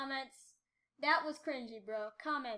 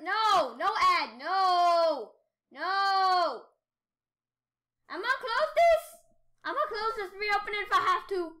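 frustrated shouting; 4 semitones higher, 10 dB louder than usual. Real, not acted. An ad pops up.

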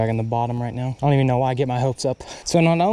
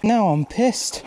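higher, slow, stretching each word out; drawn-out annoyance; 7 semitones higher, much slower than usual. Real, not acted. Angler stuck on a log, slowly stretching out his annoyance. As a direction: drag it out, fed up with the snag.

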